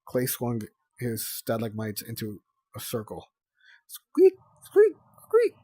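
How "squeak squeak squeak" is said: very high shouted squeaks; mock scavenger squeaking, much higher and louder; squeaky scavenger. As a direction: squeak like a tiny pest. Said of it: "he shouted, and other annoying scavenger noises".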